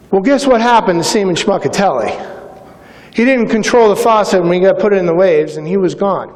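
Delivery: ordinary delivery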